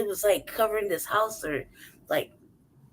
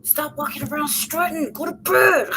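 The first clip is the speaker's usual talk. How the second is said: higher, scolding peacocks; mock scolding; 7 semitones higher than usual. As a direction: pretend telling-off of noisy peacocks.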